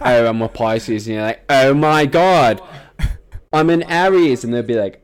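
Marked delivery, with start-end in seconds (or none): 0.0-1.1 loud mock British reality-show contestant; British accent. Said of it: "that was an amazing, um, accent". 1.5-4.4 high, loud, drawn-out British gush; hammed-up British reality-show squeal of excitement; British accent. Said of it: "that was an amazing, um, accent".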